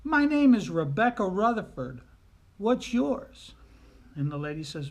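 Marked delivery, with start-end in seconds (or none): 0.0-3.5 put-on loud, high child introducing herself; little girl. Little girl answers the lady, loud and forthright. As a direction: pipe up proudly as a bold little girl. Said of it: "And she says".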